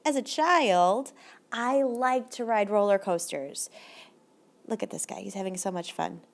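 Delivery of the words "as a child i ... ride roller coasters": slow model sentence. Shown as a demonstration. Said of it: "would be saying something like".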